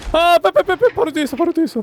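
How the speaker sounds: high frantic yells; panicked yelling. Real, not acted. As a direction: shout in panic while fleeing. 괴물이 바로 뒤에 있음. (다급하게 외치는 비명)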